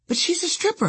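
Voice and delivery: high-pitched, slightly softened objection; deflated protest. Retold or imitated. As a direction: protest weakly, confidence slipping. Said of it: "suddenly feeling much, much less too fine".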